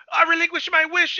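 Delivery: high, lightly acted desperate father; mock desperation. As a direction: imitate a frantic dad giving in. Panicked father gives up his wish.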